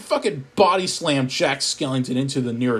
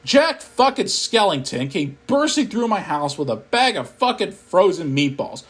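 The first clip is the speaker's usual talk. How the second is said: ordinary delivery, nothing marked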